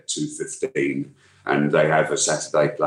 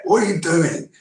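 throaty, grizzled soldier growl, higher pitched; gruff veteran voice; 12 semitones higher than usual. Shown as a demonstration. Voice actor demoing his gruff veteran character Morrow. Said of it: "Morrow talks like that".

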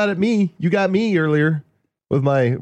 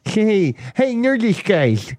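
nerd voice; 5 semitones higher, much slower than usual. Acted. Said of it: "he talked like [name] just did".

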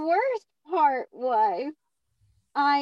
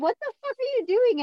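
shocked; 9 semitones higher than usual. Retold or imitated. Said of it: "And I was like".

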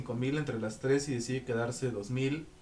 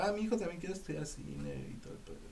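soft, higher imitation of a chatty mother; lightly voiced teacher; 4 semitones higher, 11 dB quieter than usual. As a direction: quote the teacher in a small, hushed high voice. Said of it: "porque siempre me decía".

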